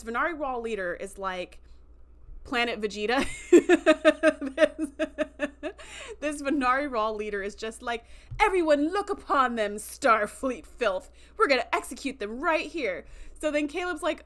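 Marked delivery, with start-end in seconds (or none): 3.3-4.5 loud, high burst of giggles; laughing. Real, not acted. Podcaster breaking up over own joke, real laughing. 8.4-13.0 loud, raised mock-villain announcement; villainous proclamation. Pirate leader to marketplace crowd over chained captives, villainous proclamation.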